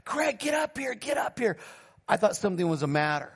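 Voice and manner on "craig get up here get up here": retold summons, voice lifted in pitch; imitated call from upstairs. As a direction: call out urgently at half volume. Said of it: "calling from upstairs".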